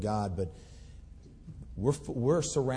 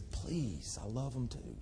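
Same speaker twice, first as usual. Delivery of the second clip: much softer, gentle pleading; tender plea; 9 dB quieter, slower than usual. Acted. God gently pleading with his reluctant people, soft and tender. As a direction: drop to a soft, pleading hush.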